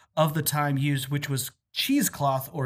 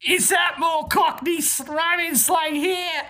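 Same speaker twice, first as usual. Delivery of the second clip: Cockney; 12 semitones higher than usual. Acted. Asking the co-host in mock Cockney. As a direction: keep the hammed-up Cockney going. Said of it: "You were succeeding".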